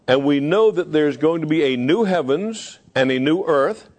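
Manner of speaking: ordinary talk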